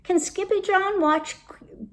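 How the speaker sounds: put-on high-pitched little kitten girl asking; little sister. A narrator's reading. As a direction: pipe up like a small sister begging a favour. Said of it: "asked Jilly Boo".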